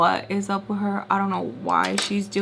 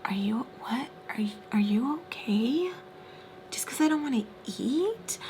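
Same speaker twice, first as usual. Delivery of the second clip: condescending; 4 semitones higher, 6 dB quieter than usual. Retold or imitated. Daughter talking down to her angry father, condescending. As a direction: imitate someone talking down, quietly and sweetly incredulous. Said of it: "condescending tone".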